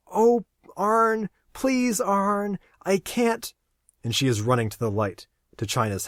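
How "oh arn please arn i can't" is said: high, breathless woman begging; gasping plea. Acted. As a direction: plead in a higher woman's voice.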